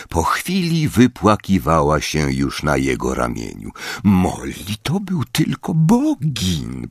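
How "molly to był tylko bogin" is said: gentle comfort. Acted. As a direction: soothe a weeping friend. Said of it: "pocieszał ją łagodnie".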